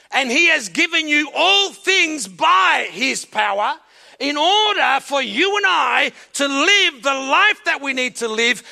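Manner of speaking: loud, building sermon shout; full-force preaching. Acted. Pastor preaching to the congregation, at full force. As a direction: preach it hard and rising.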